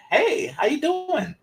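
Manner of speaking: high, slow, sweet woman's voice; woman's greeting. Retold or imitated. Woman greets Eddie Murphy at the table in high, slow voice. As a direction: sing the hello up high like a friendly stranger. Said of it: "she was like".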